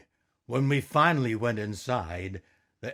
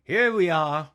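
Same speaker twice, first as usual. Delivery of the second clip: character voice; 6 semitones higher, 7 dB louder than usual. Acted. Character voiced in read story.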